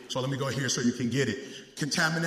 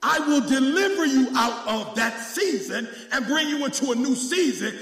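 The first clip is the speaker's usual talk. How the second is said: loud, high shouted preaching; preaching at full force; 12 semitones higher, 6 dB louder than usual. Acted. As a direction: whip the church up, full voice.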